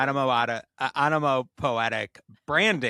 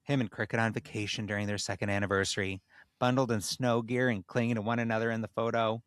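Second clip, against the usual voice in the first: measured storyteller reading; measured storyteller reading a tale aloud; story narration; faster than usual. A narrator's reading.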